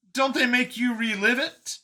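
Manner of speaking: high-pitched, startled question; surprised. A narrator's reading. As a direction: ask it in a high character voice, taken aback. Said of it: "Brian seemed surprised".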